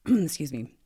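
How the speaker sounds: throat catching after swallowing something; just swallowed a bug. Real, not acted. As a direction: clear the throat, something went down wrong. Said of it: "did I just swallow a bug".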